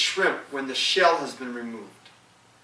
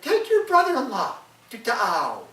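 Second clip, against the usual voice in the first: wife, higher and louder; the wife instructing her brother, higher voice; 8 semitones higher, 6 dB louder than usual. Acted. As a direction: order the brother high and loud. Wife voiced in folk tale.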